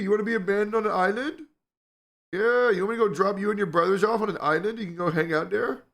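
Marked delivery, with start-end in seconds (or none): 0.0-3.5 high, fast playful pet voice. Real, not acted. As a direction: tease the kitten sweetly. Teasing the cat.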